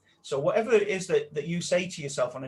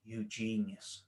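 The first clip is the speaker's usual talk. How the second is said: slow, soft, drawn-out repetition; self-affirmation mantra; 6 semitones lower, 9 dB quieter, slower than usual. Shown as a demonstration. Coach showing the inner mantra, softly and slowly. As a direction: murmur it to yourself like a mantra. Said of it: "start repeating in your head".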